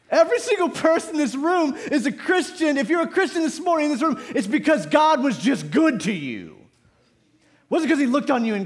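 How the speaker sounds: high, emphatic pulpit voice; full-force preaching. Real, not acted. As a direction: drive it home to the whole congregation.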